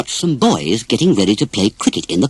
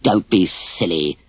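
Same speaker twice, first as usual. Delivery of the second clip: put-on slow, lower, dismissive boy; scornful; 7 semitones lower, slower than usual. A boy scorns Dumbo's offer, slow and dismissive. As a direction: talk down to him, slowly. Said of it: "said the boy scornfully".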